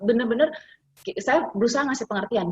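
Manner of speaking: ordinary talk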